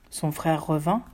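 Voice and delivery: usual speaking voice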